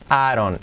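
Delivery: "Aaron": "I don't" run together with a tapped R; soft R linking. Shown as a demonstration. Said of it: "Como una R suave". ("Aaron", la D como R suave)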